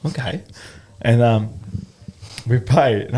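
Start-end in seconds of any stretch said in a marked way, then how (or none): 0.0-0.4 hushed aside; whispering. Retold or imitated. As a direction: drop to a whisper.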